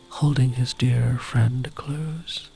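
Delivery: plain reading voice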